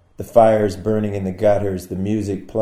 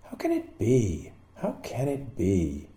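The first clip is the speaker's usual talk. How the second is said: softly, slowly wondering aloud; a soft, puzzled murmur; quiet wondering; 9 dB quieter than usual. Acted. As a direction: hush it, half to yourself, slower. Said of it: "I wonder and shake my head and ask".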